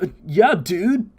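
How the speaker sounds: lightly voiced laid-back surfer drawl; a hint of a chill surfer dude. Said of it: "like, a surfer voice".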